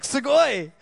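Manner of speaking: thrilled, punched-up exclamation; excited exclamation. Acted. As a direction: cry it out like you just got free money. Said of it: "somebody gives you a hundred bucks".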